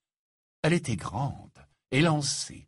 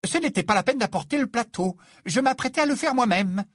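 high, loud, indignant protest; fuming, high-pitched rebuke; furious; 12 semitones higher, 5 dB louder than usual. A narrator's reading. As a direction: complain crossly with raised voice. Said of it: "l'air furibond".

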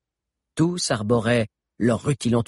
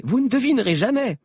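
higher, eager tease; teasing excitement; 12 semitones higher than usual. A narrator's reading. A fugitive teases her friends, high and excited. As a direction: tease them with big news.

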